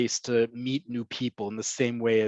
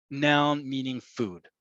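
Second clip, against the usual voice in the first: usual speaking voice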